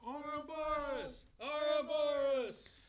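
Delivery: calling out. Acted. Character calls out a word.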